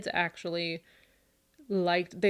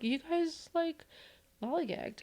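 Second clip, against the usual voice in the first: pitched-up teasing mom scold; mock scolding; 10 semitones higher, 3 dB quieter, slower than usual. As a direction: tease the kids for dawdling, high and playful. Mother scolding kids about being late.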